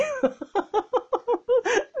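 high, stifled giggle; sneaky snicker. Retold or imitated. Remembering his face when fetching snacks, sneaky snicker. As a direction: snicker like someone hiding a secret. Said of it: "那一种窃笑".

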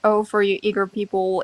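ordinary delivery

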